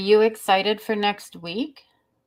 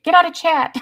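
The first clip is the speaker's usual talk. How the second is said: higher, louder teasing yell; mock shout; 8 semitones higher, 7 dB louder than usual. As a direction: bark it playfully at chat. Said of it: "Art says".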